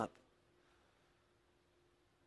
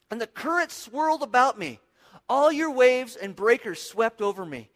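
raised, forceful cry to God; 4 semitones higher, 5 dB louder than usual. Acted. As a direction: call out urgently, pitch lifted. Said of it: "He's crying out to God".

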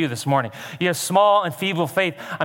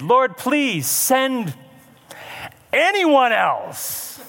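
high, drawn-out plea to God; beg, stretching the words out slowly; hammed-up, high-pitched begging; pleading; 9 semitones higher, much slower than usual. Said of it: "Again he pleaded".

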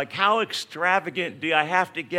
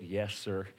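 softer, lower, sheepish reply; meek yes sir; 7 semitones lower, 8 dB quieter than usual. Retold or imitated. Caught speeder answering the cop, meek and soft. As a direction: answer small and chastened.

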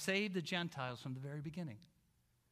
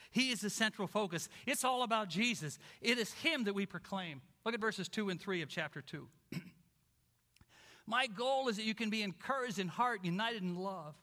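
normal talking voice

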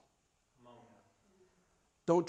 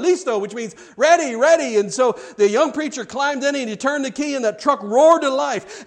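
high, loud, driving sermon story; animated preaching storytelling; 6 semitones higher, 8 dB louder than usual. Acted. Preacher telling a congregation of a sudden rescue, animated and loud. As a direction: build the excitement, punching out each action.

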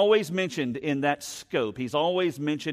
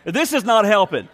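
high, loud mock outburst; inner exasperation; 6 semitones higher, 8 dB louder than usual. Pastor with a headache reacting to the cashier, loud exasperation. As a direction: blow up in exasperation. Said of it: "I'm thinking all this in my head".

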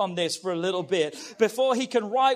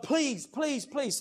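pleading; 5 semitones higher, much slower than usual. Retold or imitated. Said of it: "Three times he's pleading with God".

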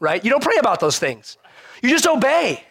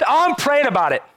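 mock pious excuse; 7 semitones higher than usual.

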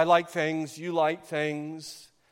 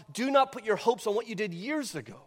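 urgent preaching; 5 semitones higher than usual. Acted. Closing appeal to the hearers.

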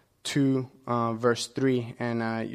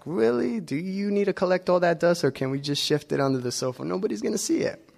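much higher, whiny, fast mock complaint; mock exasperation; 6 semitones higher than usual. Pastor imitating himself griping about cleaning, high exasperated whine. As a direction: whine about vacuuming like it's absurd. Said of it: "and it's like".

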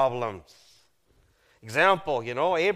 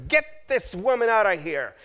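raised, higher commanding voice; barked order; 6 semitones higher, 4 dB louder than usual. Acted. Prince ordering his servants about his sister, commanding. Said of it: "He ordered his".

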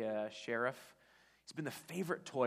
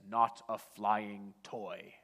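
very slow, louder disclaimer read; deadpan voiceover; 2 semitones lower, 6 dB louder, much slower than usual. Retold or imitated. The ad voiceover crushing Buzz, slow disclaimer. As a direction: read the small print flatly. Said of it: "the voiceover says".

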